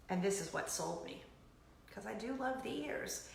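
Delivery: usual speaking voice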